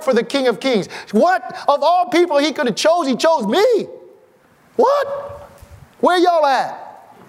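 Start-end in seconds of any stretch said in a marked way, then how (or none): 1.1-3.9 excited wonder. Real, not acted. Marvelling that God chose him. 4.8-5.5 a sudden high squeal of disbelief; amazed what. Real, not acted. Marvelling that God chose him. 6.0-7.2 slow, high call to the crowd; preacher's call-out. Real, not acted. Calling out to the congregation.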